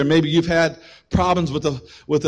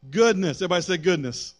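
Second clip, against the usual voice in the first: ordinary talk, nothing marked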